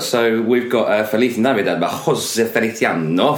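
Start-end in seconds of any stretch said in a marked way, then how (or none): 1.0-3.3 hammed-up Italian accent; playful mock-Italian delivery. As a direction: roll into an accidental Italian accent. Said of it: "I don't know why I did Italian accent".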